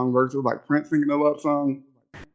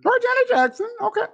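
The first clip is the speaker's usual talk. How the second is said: much higher, amused reply; laughing. Real, not acted. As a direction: laugh it out in surprise. Amused agreement while laughing.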